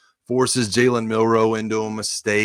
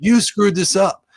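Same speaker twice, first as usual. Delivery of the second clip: higher, louder and slowed, hammering the blame; mock furious scolding, higher and slow; retold angry accusation; 8 semitones higher, 4 dB louder, slower than usual. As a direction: spit out the blame, slow and hard. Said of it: "I was so mad at him".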